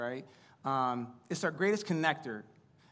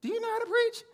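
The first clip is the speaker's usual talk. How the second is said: put-on much higher, small child's doubtful question; imitating son; 5 dB louder than usual. Son questioning his dad before preaching, child voice. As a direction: ask it like a curious little boy. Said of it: "And then he says".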